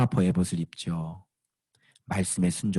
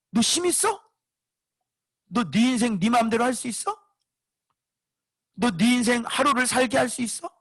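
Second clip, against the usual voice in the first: much higher, scolding his own proud self; preacher scolding his own pride, much higher; mock rebuke of self; 13 semitones higher than usual. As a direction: berate your own ego in a high sharp voice.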